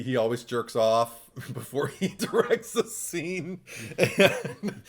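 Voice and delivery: breaking into laughter mid-sentence; laughing while talking. Real, not acted. Co-host telling what the director does before a scene, laughing through it. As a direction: tell it through bubbling laughter. Cracking up telling crude detail.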